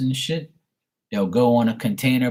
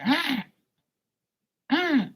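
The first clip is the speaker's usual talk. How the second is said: very high imitated heron screech. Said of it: "It's like a high pitch".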